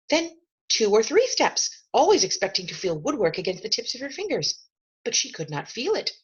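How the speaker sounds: usual speaking voice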